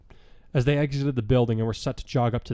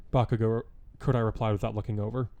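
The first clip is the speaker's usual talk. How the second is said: ordinary delivery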